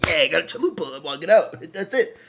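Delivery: giddy retold self-talk; gleeful mock excitement. Happy customer walking out with a chalupa, gleeful. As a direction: play carefree glee, a laugh creeping in.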